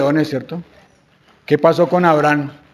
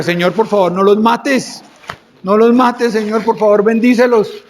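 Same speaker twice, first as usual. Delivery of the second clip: higher-pitched, urgent acted plea; pleading; 4 semitones higher, slower than usual. Moisés prays to God for the sinful people, pleading. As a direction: beg for mercy, voice climbing. Moses begs God for Israel.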